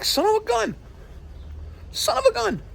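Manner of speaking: squeaky high mock exclamation of dismay. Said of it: "I'm like".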